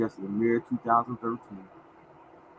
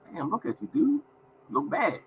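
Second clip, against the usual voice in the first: a higher mock-shocked coworker voice; coworkers gawking; 10 semitones higher, 5 dB quieter than usual. To Art on the street, mock-shocked higher coworker voice. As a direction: pitch it up like a stunned coworker.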